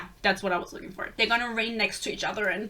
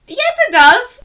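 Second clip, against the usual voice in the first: much higher and louder, blurting it out; excited outburst; 13 semitones higher, 14 dB louder than usual. Real, not acted. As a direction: shout it at the character with glee. Yelling at the book character.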